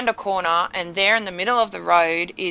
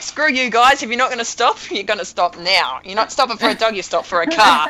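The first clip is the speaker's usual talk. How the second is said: raised, louder voice telling off careless drivers; angry rant; 4 semitones higher, 5 dB louder than usual. Retold or imitated. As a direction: call out the drivers who won't stop. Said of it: "I'm like".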